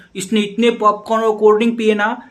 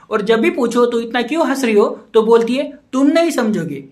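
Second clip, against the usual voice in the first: usual speaking voice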